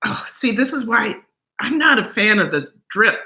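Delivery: ordinary delivery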